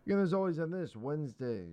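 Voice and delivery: ordinary delivery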